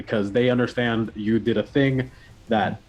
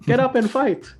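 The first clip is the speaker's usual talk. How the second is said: rallying cry; 4 dB louder than usual. Acted. GM voices Granny's rally cry.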